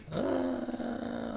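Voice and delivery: long, high, soft groan; imitated pain groan. Imitating the injured fighter yelping on the mat, groaning. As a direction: draw out a high mock moan. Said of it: "in serious pain".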